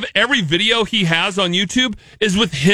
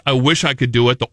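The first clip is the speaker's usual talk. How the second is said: usual speaking voice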